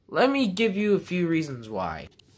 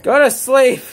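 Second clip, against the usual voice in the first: yelled, higher and much louder; shout; 8 semitones higher, 10 dB louder than usual. Real, not acted. Teen yells at mom walking past, loud shout. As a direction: call out sharply to make her go.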